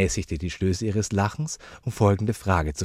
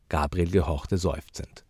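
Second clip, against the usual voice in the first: plain reading voice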